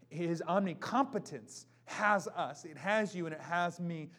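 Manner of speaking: proclamation. Acted. Rising proclamation.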